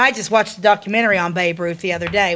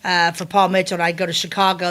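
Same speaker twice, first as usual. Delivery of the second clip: usual speaking voice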